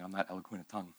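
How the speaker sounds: lightly voiced timid Moses making excuses. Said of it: "You sound like Moses going".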